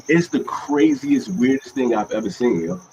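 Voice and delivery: ordinary delivery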